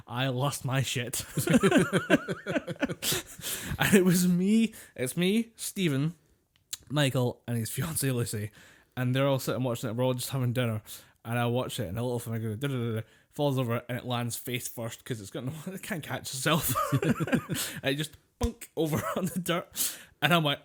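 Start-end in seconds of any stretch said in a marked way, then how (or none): 1.3-3.7 high shrieking laugh. Real, not acted. Lost it at falling chick. 16.8-17.8 high burst of laughter. Real, not acted. Laughing at chick's faceplant.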